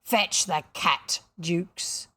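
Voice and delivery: slow, lightly voiced pirate captain; slow, menacing command; villainous captain. A narrator's reading. Hook orders Jukes to fetch the whip, captain's menace.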